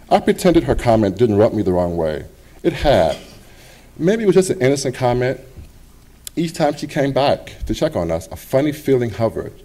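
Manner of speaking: plain reading voice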